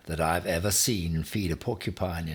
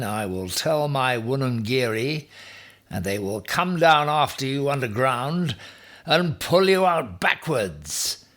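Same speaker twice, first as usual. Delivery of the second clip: higher, louder, slowing menace; rising threat; 7 semitones higher, 6 dB louder than usual. A narrator's reading. Ham threatens porcupine: rising menace.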